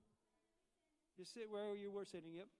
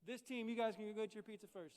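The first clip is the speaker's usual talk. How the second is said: ordinary talk, nothing marked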